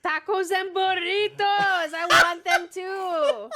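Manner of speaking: high, loud hammed-up yell; mock yell. Cohost joking about the headline, hammed-up loud yell. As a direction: belt it out like a silly stream bit. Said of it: "trying to sound Mexican yells out tacos".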